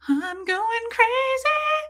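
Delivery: very high, drawn-out comic wail; mock going crazy. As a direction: stretch it out way up high, as if losing it. Acting out being driven crazy.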